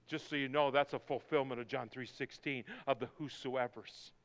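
ordinary delivery

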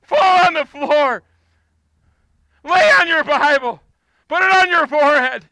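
preaching at full force; fervent preaching. Real, not acted. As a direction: drive the church to its knees. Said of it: "urging the congregation".

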